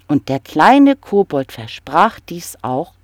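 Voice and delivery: plain reading voice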